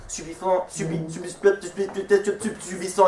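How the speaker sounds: soft, broken repeats of one word; stuck-record stutter. Acted. Slammer catches on his verse, stuttering softly. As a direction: stutter on the first syllables like a skipping record.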